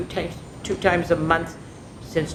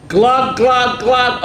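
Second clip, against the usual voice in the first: loud, bubbling glug glug; gurgling glug; 5 semitones higher, 7 dB louder, much slower than usual. Acted. Hedgehog sinking in the pond, loud gurgling glugs.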